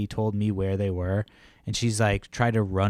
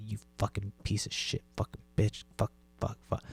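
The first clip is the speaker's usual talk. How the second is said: softer, seething string of curses; muttered fuming curses; 8 dB quieter than usual. Retold or imitated. Cursing in the car while the host stares, seething. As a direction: fume at her alone in the car. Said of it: "saying that shit under my breath".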